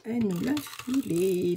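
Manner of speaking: ordinary delivery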